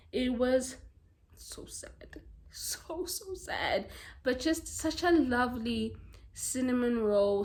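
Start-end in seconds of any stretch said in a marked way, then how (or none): 1.4-3.9 whispered for emphasis; barely voiced, soft whisper; hushed sorrow. Real, not acted. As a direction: drop to a whisper, moved by the story. Dwells on sad story.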